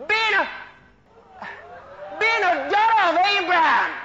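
loud, high preacher's declaration; full-force preaching. Shown as a demonstration. Preacher showing women what to tell the mirror, forceful shout. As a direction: shout it out, drawing out the big words.

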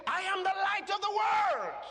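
loud, high declaration of who he is; ringing, triumphant cry; bold proclamation. Acted. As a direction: proclaim it to the whole crowd. Preacher voicing Jesus' bold claim.

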